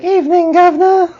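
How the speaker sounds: shouted, very high fake English accent; mock English accent. Teasing the co-host about how he talks, mock English accent. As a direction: put on a silly, high-pitched English accent, loudly. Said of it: "you with a high-pitched voice".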